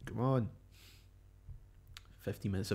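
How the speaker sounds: usual speaking voice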